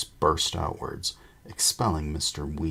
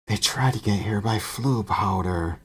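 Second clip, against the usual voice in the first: normal talking voice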